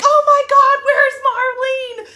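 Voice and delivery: high, loud, stretched-out cry; excited shout. Retold or imitated. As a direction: shout it in eager alarm. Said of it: "freaking messaging me like".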